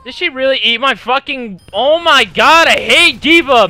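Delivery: loud frustrated rant; real rage yell at the enemy; angry outburst. Real, not acted. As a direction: blow up at the game. Killed by D.Va, raging.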